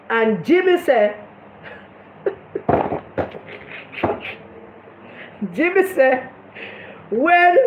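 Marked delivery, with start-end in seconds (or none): 2.3-5.9 name breaks up into wheezing laughter; can barely get the name out for laughing. Real, not acted. Laughing helplessly at Jimmy's radio story.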